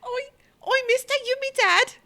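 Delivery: put-on very high, fast, loud Cockney-ish kid voice; street urchin. Urchin asking a stranger if he is dad, high squeak. Playing the scamp voice.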